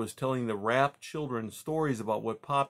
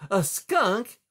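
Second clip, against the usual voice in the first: loud, high, appalled echo; shocked; 10 semitones higher, 8 dB louder than usual. Acted. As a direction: exclaim it in disbelief. Lily shocked at the news.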